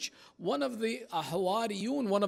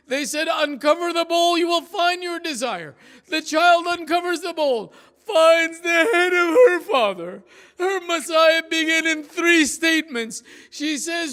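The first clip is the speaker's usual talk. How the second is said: high, loud wailing lament chant; mournful masa'ib recitation; 7 semitones higher, 9 dB louder than usual. Acted. Preacher chanting the tragedy to mourners, wailing lament.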